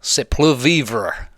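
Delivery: hammed-up fake French, drawn out; lightly put-on French accent; mock French accent. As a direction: play a cartoon Frenchman badly. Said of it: "excuse my French".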